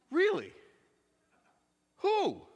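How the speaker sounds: high-pitched disbelief on the phone; astonished little questions, acted out; acted amazement. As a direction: imitate a stunned caller, pitch leaping up. Acts hairstylist's amazed phone call.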